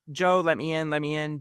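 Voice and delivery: usual speaking voice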